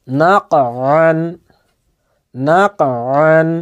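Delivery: খলাকনা and আতআমানা drawn out slowly, the ক and ত bounced; slow qalqalah recitation. Shown as a demonstration. Teacher models each word twice for learners, slowly. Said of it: "এটিকে উচ্চারণ করে আমি বলছি".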